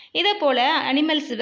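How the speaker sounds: usual speaking voice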